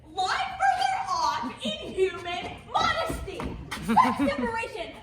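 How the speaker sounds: loud, laughing mock indignation; laughing outrage. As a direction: protest through laughter.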